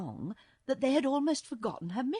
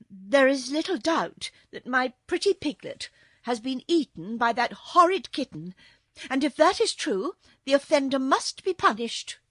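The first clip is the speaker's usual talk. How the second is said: louder, higher, emphatic accusation; indignant princess; 6 semitones higher, 10 dB louder than usual. A narrator's reading. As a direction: pronounce judgement with royal indignation. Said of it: "Ozma was angry and indignant".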